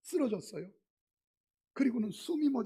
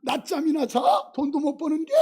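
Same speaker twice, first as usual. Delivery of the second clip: higher, louder mock scolding of a wife; 7 semitones higher, 9 dB louder than usual. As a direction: snap at a lazy husband, half-acted. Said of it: "독설이 막 부서지는데". (남편을 구박하는 아내의 가벼운 흉내 독설)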